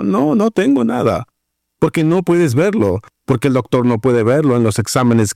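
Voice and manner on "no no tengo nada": high-pitched mock protest of having nothing; protesting believer. Believer insisting to God they have nothing, mock high-pitched protest. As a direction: imitate a helpless complainer, pitch pushed up. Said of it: "vienes diciendo".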